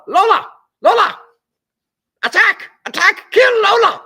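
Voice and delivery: high, loud hammed-up shout at the dog; mock attack command. Host mock-ordering the dog Lola to attack, hammed-up high shout. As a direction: yell the orders like a cartoon commander.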